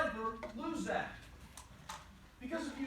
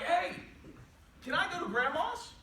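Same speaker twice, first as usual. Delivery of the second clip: put-on eager child; 7 semitones higher than usual. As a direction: ask like a hopeful little kid, pitched up. Child asking dad in a story.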